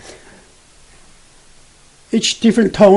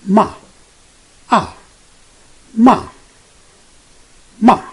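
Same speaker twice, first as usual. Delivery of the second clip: short "Ma" falling sharply from high to low; fourth tone; 5 semitones higher, 4 dB louder than usual. Shown as a demonstration. Teacher showing the fourth Chinese tone, "Ma" falling high to low. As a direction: drop "Ma" quickly from the top. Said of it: "left upper corner to the lower right corner".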